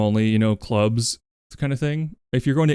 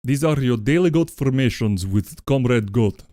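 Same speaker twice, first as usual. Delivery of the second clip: put-on Russian accent. Streamer voicing Comrade Goat in a light Russian accent. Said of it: "this accent. So let's do it in Russian".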